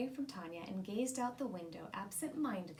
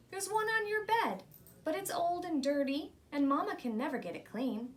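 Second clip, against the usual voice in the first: put-on eager child; 5 semitones higher, 4 dB louder than usual. Child complaining to grandma.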